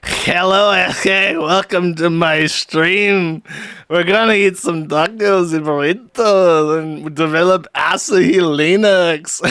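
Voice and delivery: hammed-up Spanish-accented stream greeting; thick Spanish accent. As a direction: imitate a streamer with the most Spanish accent ever. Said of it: "the most Spanish accent ever".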